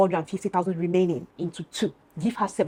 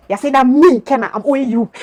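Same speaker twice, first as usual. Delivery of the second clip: louder, incredulous rant; can't believe it, let it rip; indignant outburst; 5 semitones higher, 9 dB louder than usual. Real, not acted. Retelling the debt fight, loud incredulous outrage.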